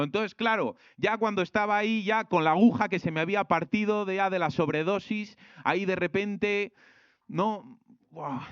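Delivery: dramatic testimony voice. Retold or imitated. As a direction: mimic an older believer's big overdose testimony. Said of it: "otros mayores diciendo". (testimonio dramático exagerado, más agudo y fuerte)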